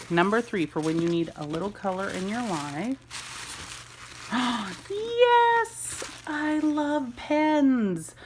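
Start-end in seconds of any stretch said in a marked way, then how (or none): 3.0-4.8 breathy delighted gasp; real gasp of delight; excited gasp. Real, not acted. Opening gift package. 4.9-8.2 high, loud yes then slow gushing; real excited squeal; thrilled squeal. Real, not acted. Opening gift package.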